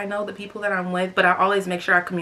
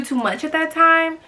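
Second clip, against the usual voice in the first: usual speaking voice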